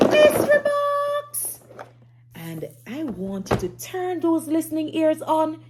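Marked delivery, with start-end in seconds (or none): 0.1-1.6 high, sing-song announcement of the mystery box; bright storyteller teaser, much higher; excited reveal. Acted. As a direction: make the mystery box sound thrilling. Teacher building suspense for children.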